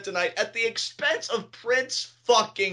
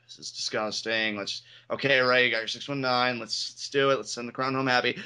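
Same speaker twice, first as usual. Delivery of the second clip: sighing words; 6 semitones lower than usual. Retold or imitated. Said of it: "I got my sighing voice on".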